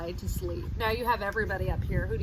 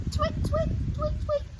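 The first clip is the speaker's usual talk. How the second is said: starling tweets; 4 dB louder, slower than usual. Acted. Reading starlings' song to children, high tweeting. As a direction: chirp it like a tiny bird. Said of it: "The starlings chittered".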